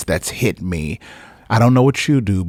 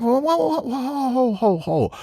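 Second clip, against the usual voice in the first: high soft alarmed whoas, well above normal; high breathy stop-right-there whoas; whoa, calming; 12 semitones higher, 3 dB quieter than usual. Acted. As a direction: pull someone back gently but urgently. Preacher stops the struggle.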